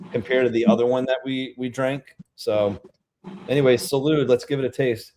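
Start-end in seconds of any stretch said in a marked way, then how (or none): none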